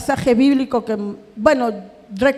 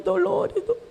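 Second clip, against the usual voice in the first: voice breaking; 6 semitones higher, 5 dB quieter, slower than usual. Real, not acted. Breaking down over life's pain.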